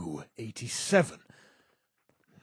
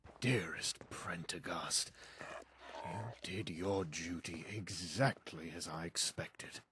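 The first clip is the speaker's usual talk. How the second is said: tender whisper; 5 semitones lower, 9 dB quieter than usual. Acted. Tender words to old friend.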